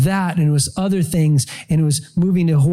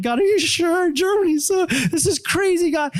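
high, slow, bewildered plea; pleading upward, half disbelieving; exasperated plea to God; 12 semitones higher than usual. Retold or imitated.

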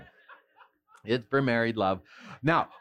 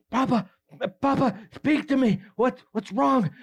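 desperate pleading; 10 semitones higher than usual. Retold or imitated. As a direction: beg him to answer, voice strained. Jesus voiced pleading to dying father.

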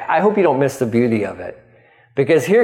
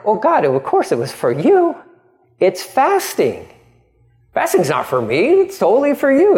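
defensive insisting; 7 semitones higher than usual. Retold or imitated. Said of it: "people answering God".

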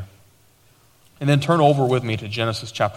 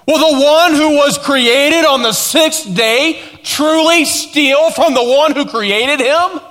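high, drawn-out, incredulous pulpit questions; full-force preaching; 12 semitones higher, 6 dB louder, slower than usual. Real, not acted. Pastor confronting listeners about robbing God, forceful preaching. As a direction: drive the question home, slow and high.

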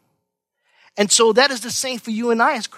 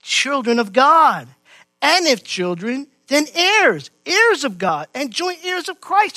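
raised, emphatic verse reading; fervent scripture preaching; 6 semitones higher, 4 dB louder, slower than usual. Real, not acted. Preacher reading the church their inheritance as heirs, fervently. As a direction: proclaim the verse, pitch climbing high.

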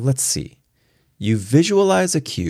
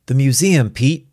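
raised, urgent plea; pleading; 7 semitones higher, 5 dB louder than usual. A narrator's reading. Museum man appealing to his friend Pete, pleading. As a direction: plead with higher pitch and some force. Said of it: "he pleaded".

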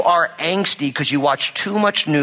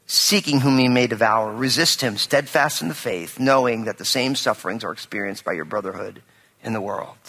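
scripture reading; 5 semitones lower than usual. Acted. Pastor reading scripture to the congregation, solemn and measured. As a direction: read the verse with weight.

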